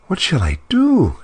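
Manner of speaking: high, panicky cry of a queen; frightened cry. Acted. Queen alone by the tree, crying out in fear. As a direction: wail it out, scared of the lions. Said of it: "she cried".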